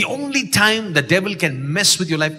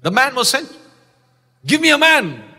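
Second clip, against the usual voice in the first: higher and louder, building; rising fervour; 7 semitones higher, 3 dB louder than usual. Real, not acted. Preacher crying out to the church, rising. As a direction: climb with each phrase.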